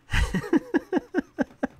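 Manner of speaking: a high, strange cackle; high villain cackle. Acted. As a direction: laugh like a greedy alien. Villain's laugh after speech.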